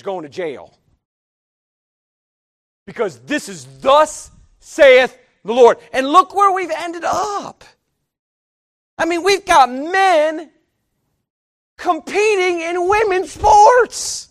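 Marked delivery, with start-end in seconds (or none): 3.8-5.8 slow, loud, weighty declaration; solemn proclamation. Acted. Preacher to congregation, a slow booming proclamation. 5.9-14.3 high, loud, slow indignant shout; outraged shout. Acted. Preacher to congregation, shouting his outrage.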